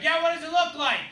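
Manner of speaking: high-pitched imitation of a neighbour's reply; calling back. Neighbour calls back to the yeller.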